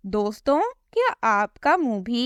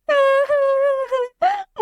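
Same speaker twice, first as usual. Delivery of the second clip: high, gloating laugh; mocking cackle. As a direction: laugh at her pain. Cackle in character voice.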